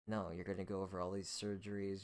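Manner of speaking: ordinary delivery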